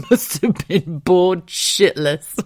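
laughing. Real, not acted. Mother joking about her unborn son, real laughter. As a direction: crack up while saying it.

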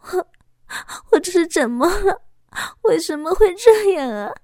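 high, tearful sobbing plea, voice breaking. A narrator's reading. Woman sobbing to her young lover, high and tearful. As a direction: she sobs out her fear of sinning. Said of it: "抽泣着说道".

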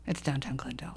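unvoiced murmur, almost to herself; whispered aside. Real, not acted. Guest whispering the location to the host. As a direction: drop to a whisper for the place name. Aside muttered under breath.

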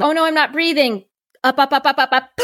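a touch of fright at not breathing; retold alarm.

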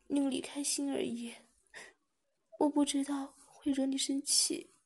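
high, teary plea with a sob, begging forgiveness; tearful pleading. Acted. Girl crying to the man she upset, tearful pleading. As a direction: voice lifted high, near tears, asking not to be abandoned. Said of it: "双眼更不停地流出眼泪".

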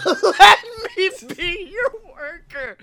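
laughing high while repeating. Acted. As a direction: repeat it cracking up.

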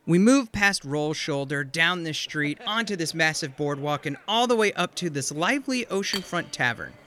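cinematic scene setting; scene narration. Acted. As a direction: sweep the camera like a trailer. GM narrates cinematic camera move.

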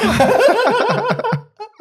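loud high-pitched laugh bursting out; burst of high laughter. Real, not acted. Retelling his brother's bossiness, bursting into a loud high laugh. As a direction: crack up loudly, way up high.

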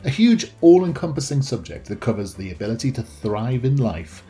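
scripted show opening read over music; podcast intro read. Acted.

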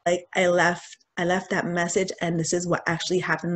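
ordinary talk

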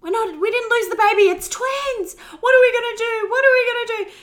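very high, loud frantic shouting; screaming down the phone in panic; panicked scream. Retold or imitated. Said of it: "I just screamed down the phone".

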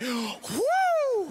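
high "woo" of relief; acted high whoop after a gasp; relieved whoop. Groom hearing his girlfriend say yes, relieved whoop.

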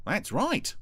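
high-pitched agreement; chirpy little character voice; chipper Brian. A narrator's reading. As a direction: chime in helpfully up high.

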